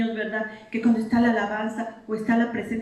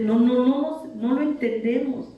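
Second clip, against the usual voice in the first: ordinary talk, nothing marked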